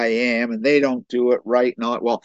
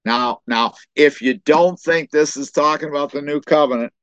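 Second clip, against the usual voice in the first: normal talking voice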